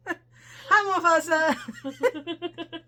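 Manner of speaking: high, giggly call; high-pitched greeting breaking into laughter; laughing greeting. Real, not acted. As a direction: crack up while calling out hello. Laughing greeting after a flub.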